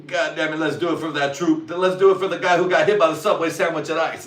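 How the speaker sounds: rally the troops, half-joking; mock rallying cry. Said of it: "salute and shit".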